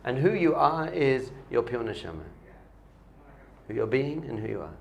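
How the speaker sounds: normal talking voice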